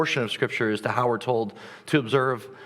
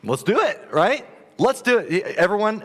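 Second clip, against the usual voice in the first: excited; 7 semitones higher, 3 dB louder than usual. Real, not acted. Rallying the congregation, pitch up.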